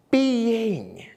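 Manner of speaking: being drawn out and leaned on as its own word; stress on being. Shown as a demonstration. Minister stressing being to the congregation, emphatic. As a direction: land hard on being, then stop. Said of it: "I'm putting extra emphasis on the word being".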